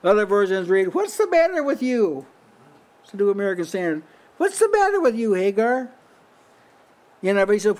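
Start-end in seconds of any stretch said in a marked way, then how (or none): 0.9-2.3 higher, lightly voiced heavenly angel; angel's voice. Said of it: "this is an angel talking". 4.4-5.9 much higher, airy angel asking Hagar; angel's voice. Acted. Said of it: "this is an angel talking".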